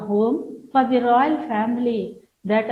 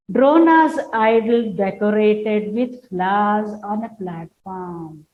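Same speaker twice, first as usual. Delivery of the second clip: louder start, trailing off at the end; stage direction reread; 4 dB louder than usual. Shown as a demonstration. Teacher rereads the stage direction while explaining, loud then fading. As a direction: repeat the scene heading, then let it drop.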